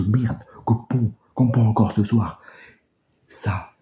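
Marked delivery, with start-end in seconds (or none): 3.3-3.7 slow, breathy disgust; disgusted whisper. Real, not acted.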